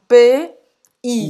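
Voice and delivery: "P" then "I", each sound said apart and loud; spelling it out. Shown as a demonstration. Teaching how to say "pays", sound by sound. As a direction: break "P I" into two separate loud sounds. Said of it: "então você vai falar assim".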